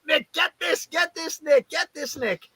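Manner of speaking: high-pitched, urgent mock scream; retold scream. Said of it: "I'm screaming down to Nick".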